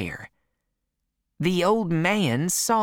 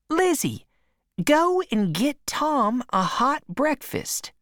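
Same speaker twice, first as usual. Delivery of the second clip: put-on higher, fond old aunt; Aunt Sally's voice; 8 semitones higher than usual. A narrator's reading. Aunt welcoming her nephew at the door, higher and fond. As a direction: gush over the boy like a doting aunt.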